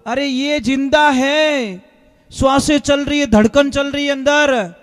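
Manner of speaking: loud, high amazed proclamation; astonished exclamation. Retold or imitated. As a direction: cry out the impossible discovery, stretching the first words. Doctor exclaims the idol is alive. (हैरान होकर ऊँची आवाज़ में)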